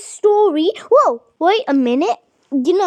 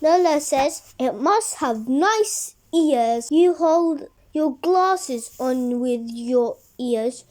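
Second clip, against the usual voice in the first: put-on reading a picture book to children, lower and steady; story narration; 3 semitones lower than usual. A narrator's reading. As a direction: tell the story to little kids. Reads a children's story aloud.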